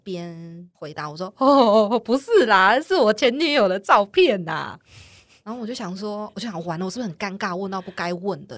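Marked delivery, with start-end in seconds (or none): 1.4-4.8 loud retold quote. Date shows ex's photo.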